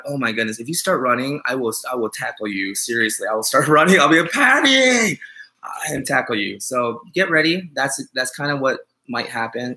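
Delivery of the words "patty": high, loud call across an airport; calling out excitedly. Pretend. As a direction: wave her down from far off.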